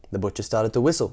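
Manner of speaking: plain reading voice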